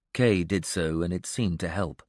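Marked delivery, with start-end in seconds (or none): none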